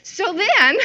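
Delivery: real laugh bursting through the words. Real, not acted. Amused by her own TV story, laughing. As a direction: speak high and loud, breaking into laughter.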